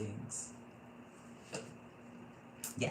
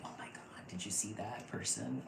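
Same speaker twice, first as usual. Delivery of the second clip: whispered, softer starstruck quote; hushed gasp; 8 dB quieter than usual. Retold or imitated. Imitating someone smitten with a crush, whispered. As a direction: whisper it like spotting your crush.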